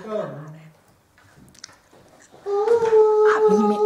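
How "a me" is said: shout. Acted. Blurting the phrase aloud.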